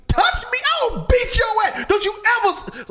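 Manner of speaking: high, loud threatening yell; mock angry shout. Father yelling at his son, high loud mock shout. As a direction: bellow a threat.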